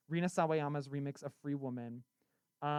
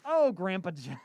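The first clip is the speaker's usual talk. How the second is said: higher, louder fond sigh; doting old lady; 4 semitones higher, 9 dB louder than usual. Pretend. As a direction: coo at him fondly. Doting on Grandpa Jeff.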